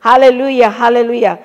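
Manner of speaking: loud hallelujah. Real, not acted. Preacher exclaims to congregation.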